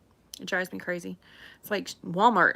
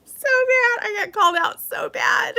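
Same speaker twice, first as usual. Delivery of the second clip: laughing, much higher, a mock wail; 13 semitones higher, 4 dB louder than usual. Real, not acted. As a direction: laugh while admitting defeat. Laughing at being called out.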